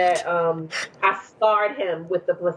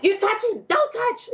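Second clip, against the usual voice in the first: high-pitched mock protest; retold frantic accusation; 14 semitones higher than usual. Mother accusing husband holding baby's head, frantic. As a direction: re-enact panicked scolding, pitch shooting up.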